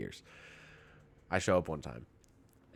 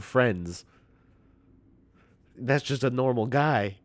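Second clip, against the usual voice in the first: louder, higher exasperated tirade; indignant rant; 4 semitones higher, 7 dB louder than usual. Retold or imitated. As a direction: rant at her in disbelief. Indignant rant retold to grandmother.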